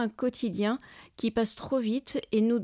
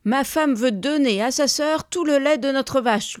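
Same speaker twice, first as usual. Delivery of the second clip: high, loud indignant explanation; furious complaint; 5 semitones higher, 7 dB louder than usual. A narrator's reading. Husband complains to the neighbour about his wife, furious. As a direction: complain with outrage to a witness. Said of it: "lui explique-t-il furieux".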